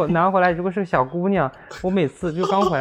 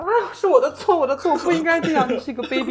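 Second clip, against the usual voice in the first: retold crying; 12 semitones higher, 4 dB louder than usual. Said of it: "我真的在那里哭".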